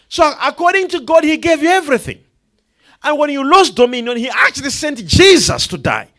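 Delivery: loud, high proclaiming voice; full-force preaching. Acted. Preacher declaring to the congregation they have power, loud. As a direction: shout the promise of power over the crowd.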